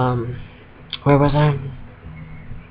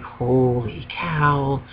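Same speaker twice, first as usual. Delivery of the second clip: awed; 5 dB quieter, much slower than usual. Retold or imitated. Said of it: "It was like".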